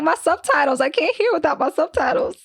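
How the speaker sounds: high, pleading cartoon whine; Velma. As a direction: panic like a helpless cartoon character. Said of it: "that Velma from Scooby-Doo?".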